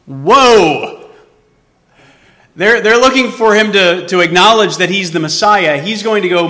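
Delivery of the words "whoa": a stunned, drawn-out whoa; astonished whoa. Acted. Preacher marvelling at Jesus' claim, astonished whoa. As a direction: gasp at how big the claim is.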